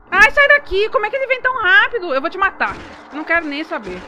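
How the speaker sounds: screaming high and loud at the monster; frantic shrieking, then threats; panicked scream. Real, not acted. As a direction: yell in real terror, fast. Monster rushes at player.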